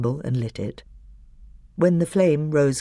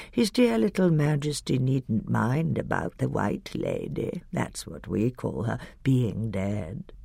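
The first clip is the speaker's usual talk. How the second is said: put-on wheedling old witch voice, softer, slow in parts; shrill whining hag; 3 semitones higher, 5 dB quieter than usual. A narrator's reading. Hag wheedling the doctor, whining softly. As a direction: whine and flatter like a crooked old crone. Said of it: "the shrill whining voice began".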